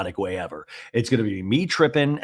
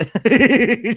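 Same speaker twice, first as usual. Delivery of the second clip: high, loud giggling; giggle fit; 11 semitones higher, 6 dB louder than usual. Real, not acted. Podcaster breaks at own joke, real high giggles. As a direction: crack up at your own impression.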